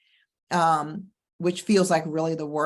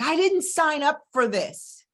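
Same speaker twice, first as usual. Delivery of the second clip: exasperated outburst; 9 semitones higher, slower than usual. Retold or imitated. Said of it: "I was like".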